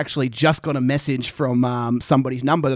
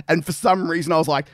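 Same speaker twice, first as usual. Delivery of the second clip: ordinary delivery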